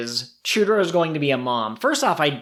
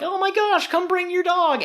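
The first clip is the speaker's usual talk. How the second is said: put-on much higher, squeaky child voice; excited little kid. Host imitating the owners' child begging, squeaky high voice. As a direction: gush it like an eager small kid. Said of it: "And the little kid's like".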